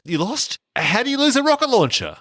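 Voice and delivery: hammed-up shocked bodyguard; mock incredulity. Retelling the rocket launcher scene, high incredulous mock outburst.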